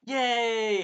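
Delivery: loud, high mock cheer; imitated gleeful yell. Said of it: "when Naofumi's like".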